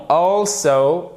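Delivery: "Also" with the weight on AL; stress on first syllable. Shown as a demonstration. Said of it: "l'accent tonique sur la première syllabe". (accent tonique sur la première syllabe de « Also »)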